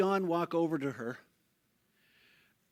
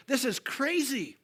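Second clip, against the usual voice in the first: higher, drawn-out exclamation of amazement; awed excitement; 6 semitones higher, slower than usual. Retold or imitated. Old guy jumping along in the crowd, awed excitement. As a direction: marvel slowly in a raised pitch.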